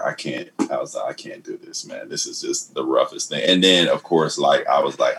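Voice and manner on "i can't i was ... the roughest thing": quiet retold despair.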